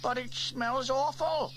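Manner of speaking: high, slow squeal of disgust; lightly voiced boy squealing; squealing Norman. A narrator's reading. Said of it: "squealed Norman".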